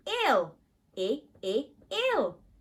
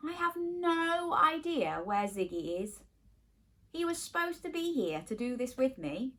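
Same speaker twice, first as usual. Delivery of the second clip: ordinary talk, nothing marked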